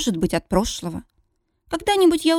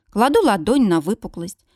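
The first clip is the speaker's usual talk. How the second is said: ordinary delivery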